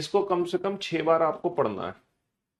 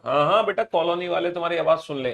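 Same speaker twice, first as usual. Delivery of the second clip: normal talking voice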